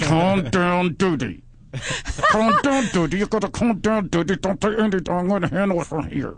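hammed-up lips-shut stage whisper, voiced; ventriloquist voice. Passenger secretly telling his friend to calm down, ventriloquist voice. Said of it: "that corner of your mouth ventriloquist".

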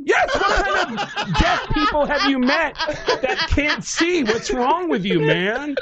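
very high, drawn-out incredulous rant; outraged disbelief. Retold or imitated. Comic answering whether deaf people see, high outraged disbelief. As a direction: stretch the words in squealing exasperation.

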